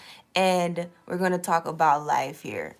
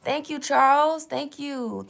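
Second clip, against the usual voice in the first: sing-song thanks; 7 semitones higher, slower than usual. Pretend. Thanking chat viewer.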